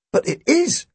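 high-pitched, naive reply; dim-witted Tim. A narrator's reading. Tim answering the police chief brilliantly, high and naive. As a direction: chirp it high and innocently.

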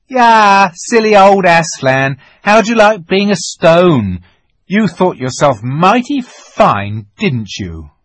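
loud, high mocking taunt; childish crowing over a beaten foe; jeering taunt. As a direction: jeer loudly, starting high and dropping as you gloat. Edmund jeers at stone lion.

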